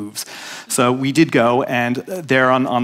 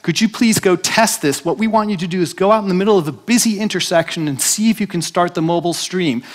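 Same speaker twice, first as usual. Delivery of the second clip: pleading colleague; 6 semitones higher than usual. Retold or imitated. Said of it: "And so we said".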